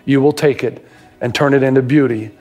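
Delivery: pastor praying over the listeners; prayer. Real, not acted. Closing prayer.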